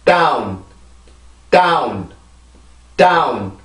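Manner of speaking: 다운 with a strong puffed d into a stressed vowel; stressed English down. Shown as a demonstration. Teacher modelling English down for students. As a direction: block the air then burst 다운. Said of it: "자, 해볼게요".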